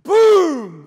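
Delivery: sudden loud, high burst; explosive boom. Acted. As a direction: shatter the hush with a booming shout.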